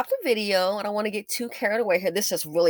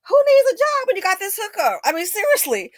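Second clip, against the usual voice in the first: much higher, louder sneer; sarcastic mockery; 13 semitones higher, 7 dB louder than usual. Real, not acted. Vlogger mocking migrants' free hookup. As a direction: sneer it like an outraged taunt.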